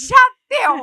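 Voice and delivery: high, shouted cut-off; hammed-up "enough" from a mom; exasperated mother. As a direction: end it with a loud mock order. Mother ends the conversation, acted.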